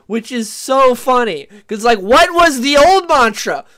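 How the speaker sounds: loud, high, incredulous rant; rising disbelief, nearly shouting; exasperated rant. Real, not acted. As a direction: vent your disbelief at full volume. Ranting about Lucasfilm's mantra.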